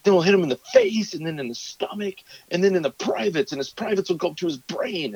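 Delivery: put-on high, gleeful little-boy battle plan; gleeful kid voice. Son describing beating the monster, high and gleeful. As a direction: relish it like a kid plotting revenge. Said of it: "And he's like".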